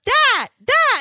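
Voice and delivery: put-on high, loud toddler cry; insistent toddler. Mother imitating toddler pointing at the wreath, insistent. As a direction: imitate a little kid urgently pointing something out. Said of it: "And he goes".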